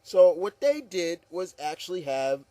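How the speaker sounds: ordinary talk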